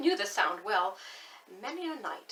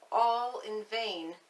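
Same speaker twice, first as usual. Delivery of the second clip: slow echo; 4 dB louder, slower than usual. A narrator's reading. Repeated phrase stretched out.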